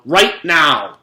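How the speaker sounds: loud, slow, furious command; angry yell. A narrator's reading. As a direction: bark it at the child who touched the notebook. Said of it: "yelled Missus Drizzle".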